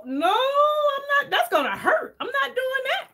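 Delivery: very high, slow crying protest; a girl hearing the talk, high mock-crying refusal; mock terrified whimper. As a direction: squeal it high like a scared teen. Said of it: "And I was like".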